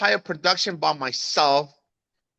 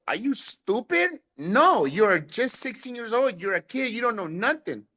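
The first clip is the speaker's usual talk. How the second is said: higher, exasperated mother scolding; scolding mom; 6 semitones higher than usual. Retold or imitated. Mom refusing her son's Wyoming trip, scolding. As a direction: tell off a foolish sixteen-year-old.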